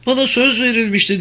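high, quick protest; girl crying out. A narrator's reading. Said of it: "diye bağırdı kız".

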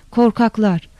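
ordinary delivery, nothing marked

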